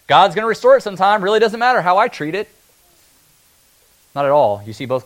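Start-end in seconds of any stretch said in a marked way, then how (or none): 0.1-2.5 higher, louder mock-careless shrug; lazy excuse. Preacher voicing careless believers, mock-casual. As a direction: play the lazy excuse-maker. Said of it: "kick your feet back and say".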